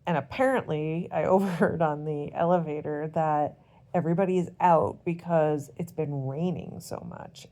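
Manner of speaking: ordinary talk, nothing marked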